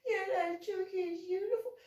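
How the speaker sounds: put-on high, soft tearful kid's voice; crying boy. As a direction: whimper it like a scared boy.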